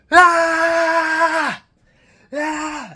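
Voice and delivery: high, very loud primal scream; full-throated scream. Retold or imitated. Alone by the statue, venting failure, raw high scream. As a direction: let out the pent-up rage in a raw scream. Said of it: "And I screamed four times".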